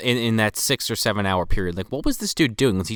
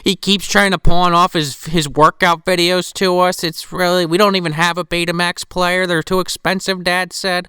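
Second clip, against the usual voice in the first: put-on louder, higher child complaining; little girl voice; 5 semitones higher, 5 dB louder than usual. Little girl telling the officer, higher and louder. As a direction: whine like a kid tattling to the cop.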